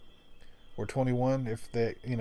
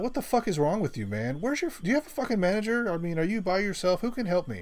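higher, furious rant at the new cashier; angry customer; 8 semitones higher, 3 dB louder than usual. Retold or imitated. Customer ranting at the new cashier over a check, higher and angry. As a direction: demand a manager, fuming.

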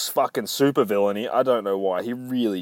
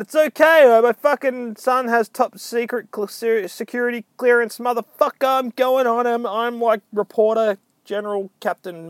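very high, loud mock bluster; blustering reporter; 12 semitones higher, 5 dB louder than usual. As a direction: bluff your way past security. Blustering reporter argues past security.

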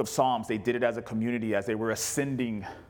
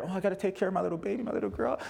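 higher, quick mock fretting over a baby; mock coddling parent; 7 semitones higher, faster than usual. Father mocking himself coddling his scared daughter, higher and quick. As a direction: lightly play the overprotective dad. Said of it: "You're scared".

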